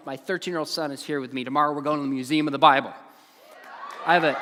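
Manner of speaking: normal talking voice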